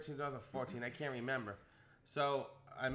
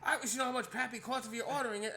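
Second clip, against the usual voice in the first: manager freaking out; 9 semitones higher than usual. Retold or imitated. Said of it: "The manager starts freaking out and threatening".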